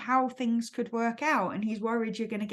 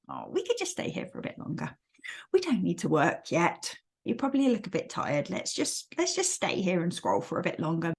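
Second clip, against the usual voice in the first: kind inner voice; 5 semitones lower than usual. Acted. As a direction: gently tempt yourself to stay cosy. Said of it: "That voice is quite a kind voice".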